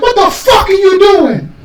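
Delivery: high, slow mock yell as mom. Mom shouting at her son, mock high yell. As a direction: ham up an angry mom's shout. Mom catches him blowing cartridge.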